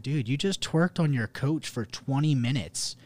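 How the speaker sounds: retelling an exasperated accusation; retold indignant confrontation. Retold argument with the girl.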